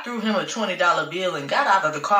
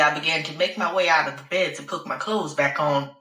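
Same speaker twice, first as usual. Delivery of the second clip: ordinary delivery, nothing marked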